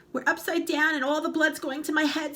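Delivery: high, frantic scream. Acted. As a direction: shriek in panic. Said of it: "screamed Missus Twit".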